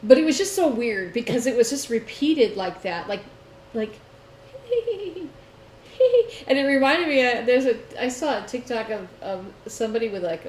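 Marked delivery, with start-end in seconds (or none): 4.5-5.3 put-on high little-kid giggle; eerie child giggle. Recalling the giggle that kept repeating, high child laugh. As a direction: mimic the creepy repeated laugh.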